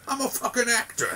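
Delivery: high, brash mock New Jersey voice; mock Jersey actor. Mocking Meryl Streep's accent, high brash actor line. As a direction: snap it like a hammed-up Jersey star. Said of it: "That's what I say".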